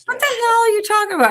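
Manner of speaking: high-pitched, affronted disbelief; indignant outburst. Real, not acted. Woman answering a jab about her curling iron, indignant. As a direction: fire back, scandalized by the tease. Indignant retort to hair teasing.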